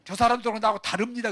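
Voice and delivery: loud, quick, indignant protest; 베드로. Acted. Peter setting himself apart from the others, indignant protest. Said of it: "베드로가 화를 내면서".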